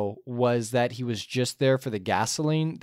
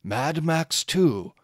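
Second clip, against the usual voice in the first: mock epic narration; 4 semitones lower than usual. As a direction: narrate like the film's old narrator looking back. Parody Road Warrior closing narration.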